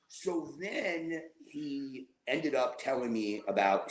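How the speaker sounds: normal talking voice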